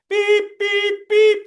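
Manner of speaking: high, loud reversing beeps; forklift beeping. Pretend. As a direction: beep like a truck backing up. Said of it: "it's a forklift".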